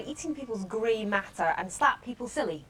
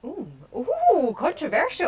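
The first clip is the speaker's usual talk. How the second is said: mock shocked; 5 semitones higher, 7 dB louder, slower than usual. Real, not acted. Teasing reaction to unfinished game.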